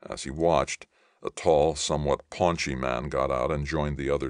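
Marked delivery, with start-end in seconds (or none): none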